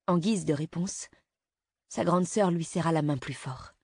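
usual speaking voice

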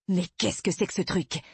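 père en colère, sans vraiment crier; colère jouée avec retenue; vocifération contenue. A narrator's reading. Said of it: "vociféra-t-il". (gronder, légèrement théâtral)

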